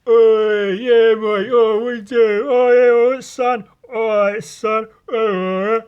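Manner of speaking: loud, high-pitched mock lad bellowing; lad cadence. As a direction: caricature loutish lads, drawing the words out into noise. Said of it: "I cannot stand that fucking cadence".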